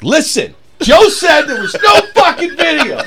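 very high, loud comic outburst; furious mock yell. Opener to club owners about the video rule, mock-furious yell.

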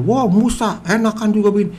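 high-pitched mock complaint at a leader; whiny-crowd protest, retold; grumbling Israelites. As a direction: imitate a griping crowd, pitched well up. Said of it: "dimaki-maki sama orang Israel".

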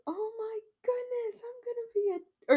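very high, tearful squeal of joy; hammed-up high-pitched disbelief; mock overwhelmed. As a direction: imitate a stunned dad-to-be squealing. Acting the news of becoming dad.

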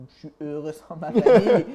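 laughs right through the words; loud burst of laughter mid-sentence; laughing. Real, not acted. Joking to the interviewer about being happy without family, laughing aloud.

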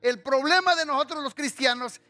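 high, forceful preacher with drawn-out words; impassioned preaching. Acted. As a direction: exhort the congregation, stretching key words. (predicación apasionada, aguda y con palabras alargadas)

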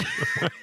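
high-pitched helpless laugh. Real, not acted. Laughing over the friend getting snow shoved, high laugh. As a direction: laugh high, without words.

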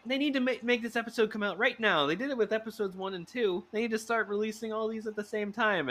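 high-pitched indignant rant; mock anger. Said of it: "I turned to anger".

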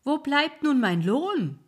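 high, loud, slow call; the old man calls after them for his wage; loud, high demand for payment; ferryman demanding pay. Acted. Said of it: "der Alte rief".

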